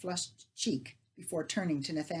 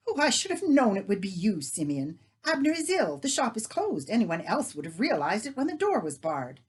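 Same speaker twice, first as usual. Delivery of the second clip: a touch shrill and irritated, louder; 4 semitones higher, 6 dB louder than usual. Acted. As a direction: brush off the neighbour at the door. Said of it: "a little shrill. She sounded irritated".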